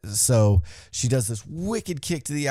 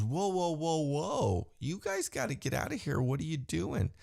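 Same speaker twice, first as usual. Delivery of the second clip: mock referee; 6 semitones higher, 7 dB quieter than usual. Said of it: "the ref goes".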